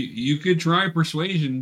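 ordinary delivery

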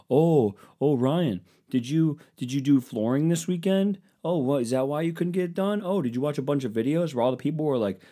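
put-on nosy questioning; mock questioning. Mockingly voices an imagined critic.

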